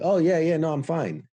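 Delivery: high, quick dismissive reassurance; brushing it off. Retold or imitated. Veteran deflecting friends' concern, high and dismissive. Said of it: "And like".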